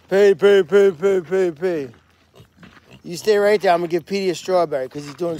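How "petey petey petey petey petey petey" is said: loud calls to the pet; calling out. Real, not acted. Owner calling pet for a strawberry, loud call. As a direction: call him over loudly.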